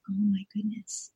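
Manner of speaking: slow hushed whisper; soft realization. Shown as a demonstration. Parent softly realizing she wasn't listening, hushed whisper. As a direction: breathe it out gently, almost a whisper.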